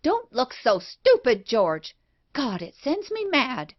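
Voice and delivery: high angry shout at him. A narrator's reading. Wife shouting at husband for looking stupid. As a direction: snap at him in exasperation. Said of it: "she shouted at me".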